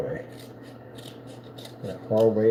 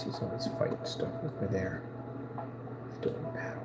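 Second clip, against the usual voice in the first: hushed whisper; 3 semitones lower, 13 dB quieter than usual. Real, not acted. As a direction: whisper so the cat isn't disturbed. Whispering about the cat.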